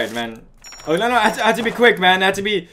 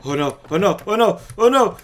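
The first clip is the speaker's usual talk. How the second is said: ordinary delivery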